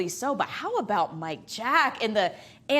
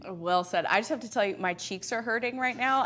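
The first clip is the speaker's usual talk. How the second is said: normal talking voice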